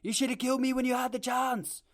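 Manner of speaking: a better stab at an Irish brogue; Conor McGregor accent. Retold or imitated. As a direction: podcaster retries his McGregor impression, Irish accent. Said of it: "That's a little bit better".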